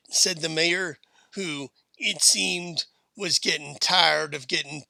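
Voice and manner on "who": ordinary delivery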